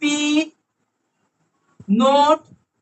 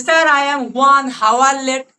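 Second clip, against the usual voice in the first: "Sir, I am one hour late" said slowly and a little louder; slow demo sentence; 5 dB louder, much slower than usual. Shown as a demonstration. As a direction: spell out the sentence for the class. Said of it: "বলি, বলি না".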